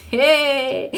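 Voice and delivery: amused disbelief, laughing; laughing hey. Real, not acted. Reacting to God's answer.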